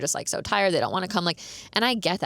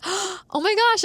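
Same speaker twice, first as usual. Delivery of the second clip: higher, slow thrilled gasp of surprise; delighted gasp; 13 semitones higher, 4 dB louder, much slower than usual. Retold or imitated. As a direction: gasp and stretch it out, overjoyed. Gasping at his change of plans.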